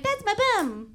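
put-on high, cutesy baby voice; demonstrated baby talk. Shown as a demonstration. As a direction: squeal it playfully in baby talk. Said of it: "Give us an example".

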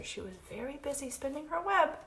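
ordinary delivery

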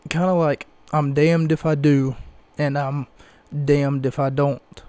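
normal talking voice

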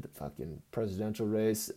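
usual speaking voice

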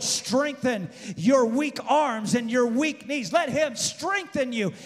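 high, loud revival preaching; full-force sermon cry; fervent preaching. Acted. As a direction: preach it out to the congregation.

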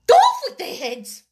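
very high, loud royal command; screamed order. A narrator's reading. The Queen condemns the gardeners, screaming. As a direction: shriek the sentence.

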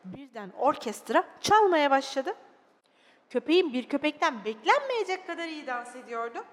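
expressive, dramatised storytelling; children's story narration. Acted. Narrator telling children of a sad little dog, expressive storytelling. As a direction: read it to small children with feeling.

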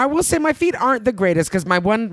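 usual speaking voice

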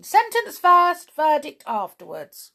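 decree the first words high and loud; imperious queen. A narrator's reading. Queen overruling the verdict, loud imperious decree.

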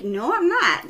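high-pitched retold protest; mock stubborn no; defiant refusal. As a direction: refuse outright, playfully indignant. Acted refusal to manager.

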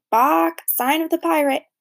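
loud squawk, then high parrot chatter. A narrator's reading. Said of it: "he sounds just like a broken record".

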